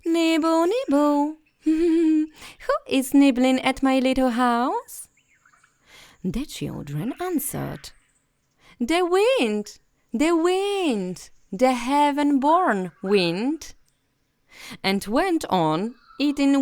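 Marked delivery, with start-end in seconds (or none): 0.0-5.1 high, louder sing-song call; witch calling. Acted. Hidden witch calling to nibbling children, high sing-song. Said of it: "a soft voice cried". 8.8-13.7 put-on high, louder childlike chant; children answering. Children fibbing to the voice in the house, high chant.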